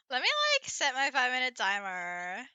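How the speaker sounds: put-on Valley Girl accent, upspeak and vocal fry. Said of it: "be redeemed Valley Girl accent".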